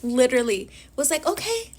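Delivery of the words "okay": much higher, eager okay; excited Fritz's eager okay; excited butler. Retold or imitated. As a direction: chirp it up high with delight. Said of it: "he was excited".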